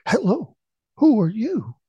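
high, hooting echo of a call. A narrator's reading. Mountain echo answers the startled boy, high echoing call. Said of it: "the voice echoed back".

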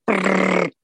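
rapid trilled chewing sound; chewing noise. A narrator's reading. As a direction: imitate a rabbit gnawing sticks. Imitates Rabbit chewing sticks.

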